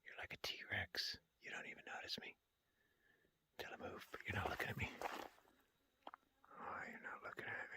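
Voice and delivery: stealthy whisper to a wary animal; hushed whisper. Real, not acted. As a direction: whisper so the deer won't notice you. Sneaking near wary deer, barely audible.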